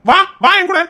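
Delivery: high, loud, urgent order; angry shout. Acted. Brother demanding his sister come with him, angry shout. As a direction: shout at her to come along.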